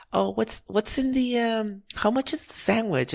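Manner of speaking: high-pitched imitation of a dithery old lady; cute old lady. Old lady asking the sandwich clerk prices, cute high voice. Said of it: "this is how cute she was".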